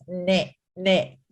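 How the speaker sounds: nasal pinched-nose "ne"; pinching nose. Shown as a demonstration. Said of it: "like if I pinch my nose and say it".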